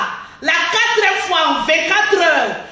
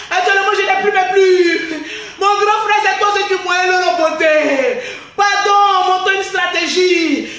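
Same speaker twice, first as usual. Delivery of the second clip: mock tearful pleading; 3 semitones higher than usual. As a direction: imitate a cook crying for help. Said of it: "les pleurs de la cuisinière".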